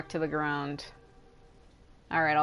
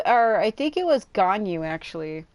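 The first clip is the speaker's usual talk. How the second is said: ordinary talk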